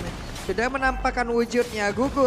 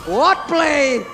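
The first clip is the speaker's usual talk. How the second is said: louder shout breaking into a laugh; amazed shout; 3 semitones higher, 5 dB louder, slower than usual. Real, not acted. Caster stunned by Kabuki's play, shouting with a laugh. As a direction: yell it in disbelief, laughing.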